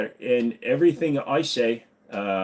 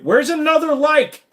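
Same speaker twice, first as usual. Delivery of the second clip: squeaky voice; 7 dB louder than usual. Acted. Begging for likes.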